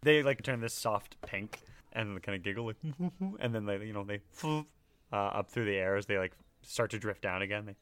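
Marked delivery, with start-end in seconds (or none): none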